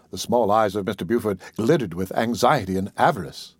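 usual speaking voice

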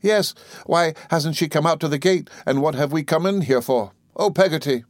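put-on high, pleading boy; upset child demanding answers; distressed child. A narrator's reading. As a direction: plead anxiously, pitched high. Tearful boy pleads with nurse.

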